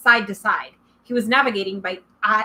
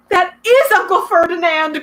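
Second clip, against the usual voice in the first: high, loud, gasping alarm; gasped; 8 semitones higher, 6 dB louder than usual. A narrator's reading. Satyr recognising his petrified uncle, gasping high alarm. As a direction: blurt it out in shock and fear. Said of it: "Grover gasped".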